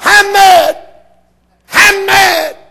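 high, loud, shouted "I'm mad"; impersonating a fiery preacher. Retold or imitated. Doctor Hudson shouting from his pulpit tape. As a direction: bellow it like an angry pulpit preacher. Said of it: "in his, only his way".

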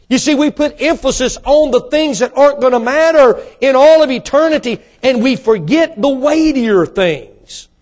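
high, loud pulpit delivery slowing at the end; impassioned preaching. Real, not acted. Preaching at full force.

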